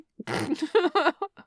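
high bright giggle. Acted. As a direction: burst into a teasing laugh. Laughing at shared memory.

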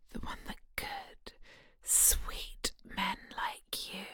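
slow, intimate whisper; seductive whisper. Acted. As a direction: whisper it close and tempting. Seductive whispered hypnosis continues.

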